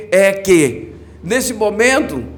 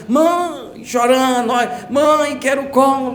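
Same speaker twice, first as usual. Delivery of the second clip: put-on child crying for mother; 6 semitones higher, slower than usual. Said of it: "o teu filhinho tá lá chorando".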